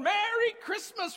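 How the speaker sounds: put-on high, thrilled shout of a delighted boy; gleeful cry; excited kid. Boy tossing horse poop, overjoyed shout.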